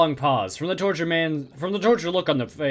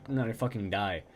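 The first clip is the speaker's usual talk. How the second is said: ordinary talk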